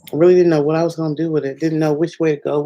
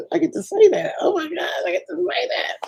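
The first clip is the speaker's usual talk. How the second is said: high excited near-shriek; real giddy joy, voice cracking high; giddy squeal. Real, not acted. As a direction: squeal with delight. Giddy over saying subscribe line.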